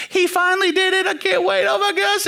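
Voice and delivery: high-pitched gushing fiancée impression; mock squeal of engagement news; excited imitation. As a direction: gush the big news in a put-on high voice. Voices fiancée phoning friends the news.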